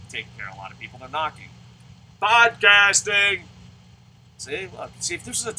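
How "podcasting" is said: announcer shout. Acted. Announces a word loudly.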